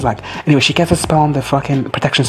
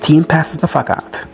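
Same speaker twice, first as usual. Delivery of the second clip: ordinary talk, nothing marked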